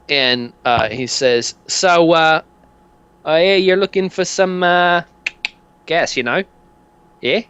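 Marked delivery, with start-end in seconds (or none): 1.7-7.4 higher, sly sales pitch; hint at it like a shifty mate; shady dealer. Acted.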